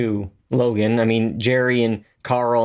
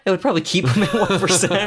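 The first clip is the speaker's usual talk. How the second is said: laughing; 6 semitones higher, faster than usual. Real, not acted. Laughing at a silly idea.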